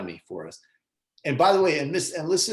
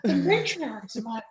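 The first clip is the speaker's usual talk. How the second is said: high, hammed-up Warren voice; Warren impression; 10 semitones higher than usual. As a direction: imitate Warren, pitched up. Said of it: "can you do a Warren?".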